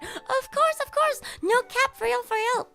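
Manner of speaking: high, sing-song fake sincerity; sarcastic reassurance to sponsors; mock earnest promise.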